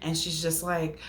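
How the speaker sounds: usual speaking voice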